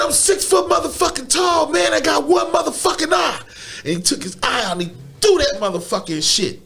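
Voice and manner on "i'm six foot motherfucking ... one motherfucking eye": high pitched mock-tough yell; retold yell. Friend boasting at the man, high hammed-up yell.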